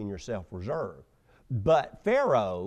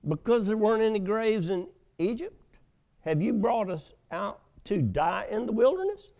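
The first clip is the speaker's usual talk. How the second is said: complaining Israelites; 8 semitones higher than usual. Acted.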